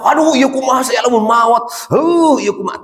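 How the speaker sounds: high, frightened mock wail; panicked cry. Preacher mocking what a panicked man would cry, high fearful wail. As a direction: cry out as if certain to die. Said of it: "Sugan berteriak-teriak".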